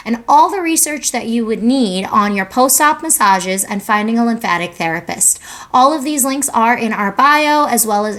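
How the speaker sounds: loud, bright-paced promo intro read. Acted. Owner reading her clinic promo to viewers, upbeat ad read. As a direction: announce the business like a commercial spot.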